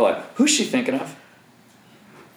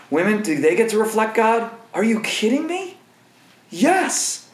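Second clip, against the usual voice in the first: excited; 7 semitones higher than usual. Real, not acted. Rhetorical outburst about women.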